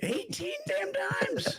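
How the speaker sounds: hammed-up Scottish, high and drawn out; Robin Williams Scottish. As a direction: drag each word out in a squeaky Scottish brogue. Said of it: "the Scottish accent thing".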